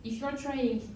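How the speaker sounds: normal talking voice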